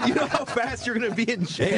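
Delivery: high-pitched mock warning, half laughing; retold incredulous scolding. Friend warning a comic about jail, high incredulous scolding. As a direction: scold in disbelief, imitating an alarmed friend.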